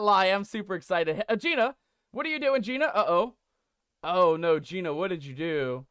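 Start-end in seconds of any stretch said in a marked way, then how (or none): none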